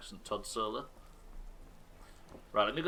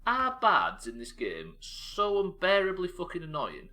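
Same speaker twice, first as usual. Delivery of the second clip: slow exasperated rant; 7 semitones higher, 4 dB louder, slower than usual. Real, not acted. Ranting about annoying bards.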